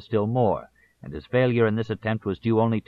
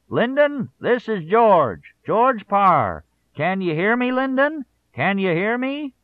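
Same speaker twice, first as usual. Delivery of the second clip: very high, loud shout over a bad phone line, slow; shouting George Parr; 11 semitones higher, 5 dB louder, slower than usual. A narrator's reading. Parr shouts to Johnson over a staticky phone line. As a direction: yell down a crackly long-distance line. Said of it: "pretend to be Parr calling on election night and shouting".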